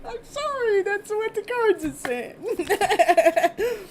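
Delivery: high, slow apology through laughter; laughing apology. Real, not acted. Reader to client after her joke: real laughing apology. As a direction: apologise while still cracking up.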